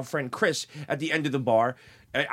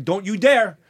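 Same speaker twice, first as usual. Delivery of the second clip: raised, forceful warning; retold warning; 4 semitones higher, 4 dB louder than usual. Bartender warning off a charging drunk, retold warning. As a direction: warn him off, pitch climbing.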